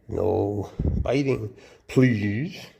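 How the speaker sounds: slow, pleading request to the snake; slow coaxing warning. Real, not acted. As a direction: stretch every word like a patient plea.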